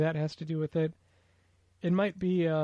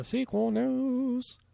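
1 announcer voice; 8 semitones higher, 3 dB quieter, much slower than usual. Pretend. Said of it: "echoing the segment title".

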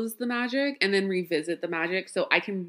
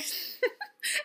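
breathless high giggle; airy high laugh; 5 dB quieter than usual. Real, not acted. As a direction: giggle in a rush of air. Laughs at her own joke.

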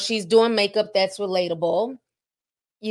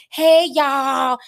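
hammed-up Southern "Hey, y'all," higher and louder; mock Southern drawl; 6 semitones higher, 4 dB louder than usual. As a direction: play up a heavy Southern accent like a cooking host. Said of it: "it's like this heavy Southern drawl".

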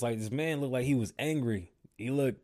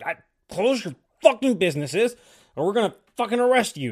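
imitating the governor barking orders, higher and louder, speeding up; mock furious governor; 7 semitones higher, 6 dB louder than usual. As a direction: play an angry governor threatening shop owners. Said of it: "he was like".

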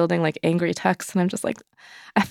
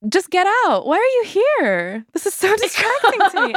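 exasperated inner rant. Retold or imitated. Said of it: "And it's like".

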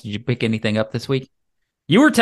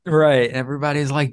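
usual speaking voice

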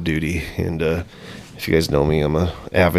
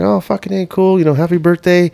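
high-pitched birthday congratulations; imitated friendly brother; 9 semitones higher than usual. Brother congratulating Matty mid-game, high friendly imitation. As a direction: congratulate him in a lifted, cheery voice. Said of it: "He's like".

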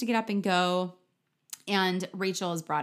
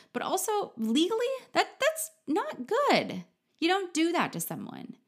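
incredulous; 10 semitones higher than usual. Real, not acted. Outraged at filing restraining order.

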